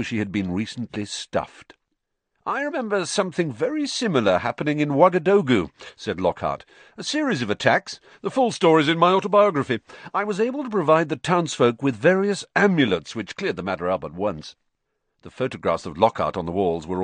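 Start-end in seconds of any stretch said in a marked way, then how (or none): 2.4-5.7 high, quick, breezy know-it-all; busybody showing off; boastful Lockhart. A narrator's reading. 7.0-14.5 high, quick, breezy know-it-all; busybody showing off; boastful Lockhart. A narrator's reading.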